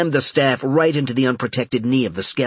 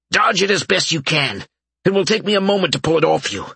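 raised urgent call, quick; 7 semitones higher than usual. A narrator's reading. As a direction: hurry the warning out over the fight. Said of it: "Albert called out".

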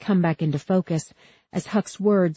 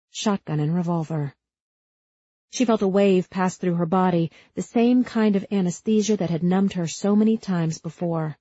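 plain reading voice

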